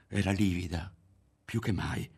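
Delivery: ordinary delivery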